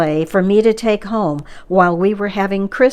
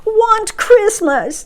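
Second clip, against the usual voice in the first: high, very slow, whining troll plea; miserable trolls; 14 semitones higher, 4 dB louder, much slower than usual. A narrator's reading. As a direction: drag it out like a sulking little troll. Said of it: "sounding miserable".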